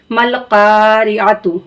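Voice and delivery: मल कारिआतु with का stretched long; slow demo of the long का; drawn long vowel. Shown as a demonstration. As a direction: hold the aa of का in कारिआतु. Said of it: "तो हम काफ को क्या करेंगे? खींच कर पढ़ेंगे".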